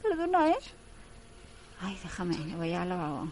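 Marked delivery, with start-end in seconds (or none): 0.0-0.6 put-on high, loud clumsy girl apologising; mock-flustered high apology; hammed-up apologetic Sun. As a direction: squeal out an overdone sorry. Sun spills coffee, voiced.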